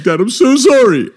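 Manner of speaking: high, loud wail to dad; hammed-up desperate sorry; panicked apology. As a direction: beg frantically like a scared teen. Retold terrified apology to dad.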